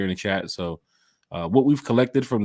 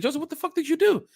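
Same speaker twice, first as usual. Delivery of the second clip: much higher, exasperated mock disbelief; incredulous question. Asking Joseph why security kicked him out, high and incredulous. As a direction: throw up your hands at your friend.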